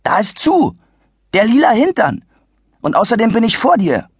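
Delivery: put-on exasperated kid; indignant boy. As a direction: insist the way is shut. Adi protests.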